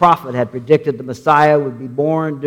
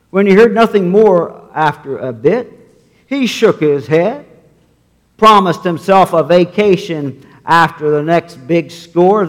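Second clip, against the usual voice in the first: normal talking voice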